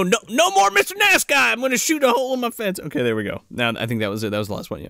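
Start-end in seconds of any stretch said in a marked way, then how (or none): 0.0-2.8 high, fast put-on rant; mock farmer threat. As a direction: spit out the cranky threat in a silly voice. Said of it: "You know".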